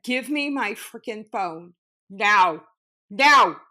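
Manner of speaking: loud, rising to a shout on the last now; furious demand for the phone; angry demand. Retold or imitated. Retold demand to friend.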